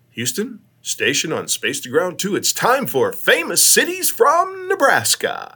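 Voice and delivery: high, drawn-out showy announcement; game-show announcer. Retold or imitated. Astronaut on the horn to Houston, game-show announcer flourish. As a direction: build to a slow, soaring title call. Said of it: "I would get on the horn".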